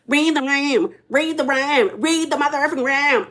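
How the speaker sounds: mock a bratty cartoon snarl; Cartman impression. Vlogger mimicking Britt telling Angela off, as a snarling Cartman. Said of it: "she sounds like".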